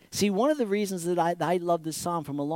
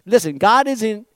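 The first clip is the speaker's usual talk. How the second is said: ordinary talk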